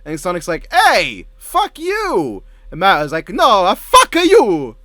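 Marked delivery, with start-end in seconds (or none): 0.7-2.4 high-pitched cartoon outrage; Sonic protesting. Acted chair quarrel as Sonic. 3.3-4.7 high, loud mock-Italian retort; Mario Italian accent. Acted quarrel as Mario.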